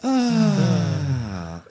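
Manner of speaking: loud, high breathy sigh of relief; sexy sigh. Shown as a demonstration. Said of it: "just give me a more sexy voice".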